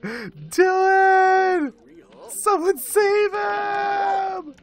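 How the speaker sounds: high, drawn-out shriek of alarm; panicked screaming. Real, not acted. Player shrieking for someone to save Dylan, panicked. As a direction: cry out in a high panic for help.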